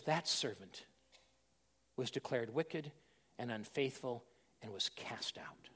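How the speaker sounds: low, slow, whispered sentence; whispered judgment. Acted. Preacher pronounces servant's fate, low whisper. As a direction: breathe the verdict gravely.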